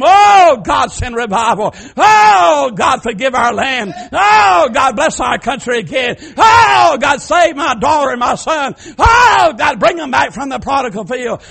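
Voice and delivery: fervent shouted prayer, Oh cried out; crying out to God. Acted. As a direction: beg God out loud at the altar. Voicing people crying out at altar.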